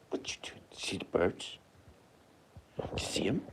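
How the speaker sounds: whisper, pointing out the birds; hushed, not to scare the birds; whispered to dog. Real, not acted.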